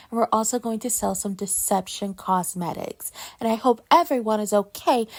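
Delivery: careful, polished posh accent, mock-refined greeting. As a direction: put on a posh accent, crisply enunciated. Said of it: "this posh".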